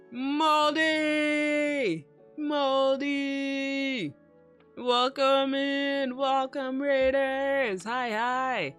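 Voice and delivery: high, loud, drawn-out welcome; excited greeting. Real, not acted. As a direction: call out to the raiders with joy. Greeting incoming raiders loudly.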